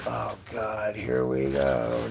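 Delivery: low, drawn-out resignation; weary dread. Retold or imitated. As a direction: groan it inwardly, expecting the worst. Father dreading son's movie phase.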